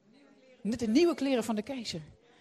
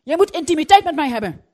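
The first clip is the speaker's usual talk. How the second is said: loud, insistent demand; wife loudly demanding her husband open up; 4 semitones higher, 15 dB louder, faster than usual. Retold or imitated. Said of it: "En wij zeggen onze man".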